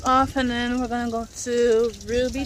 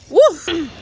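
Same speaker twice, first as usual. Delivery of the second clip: loud excited whoop at the waves; 6 semitones higher, 9 dB louder than usual. Real, not acted. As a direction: let out a thrilled whoop. Excited about the big waves.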